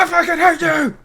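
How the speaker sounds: sudden high shouted outburst; mock yell. Yelling at his friend in a hammed-up fury. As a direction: blast it out in fake fury.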